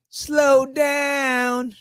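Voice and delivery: high, stretched-out words; drawn-out slow down. Shown as a demonstration. Host wishing the month would slow down, drawn out.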